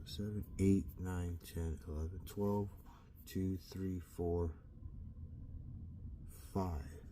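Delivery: softly recounting the hours; counting under breath. Real, not acted. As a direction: count again quietly, almost to yourself. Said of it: "let me count that again".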